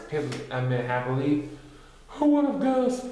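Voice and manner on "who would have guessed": laughing. A narrator's reading. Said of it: "Pooh laughed".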